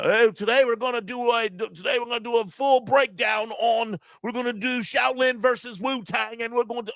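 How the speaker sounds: mocking British host announcing a video; mock British accent. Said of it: "people that don't look like me".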